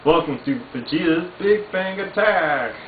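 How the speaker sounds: Vegeta impression. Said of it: "Or Vegeta".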